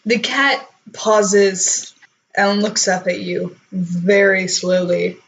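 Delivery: ordinary delivery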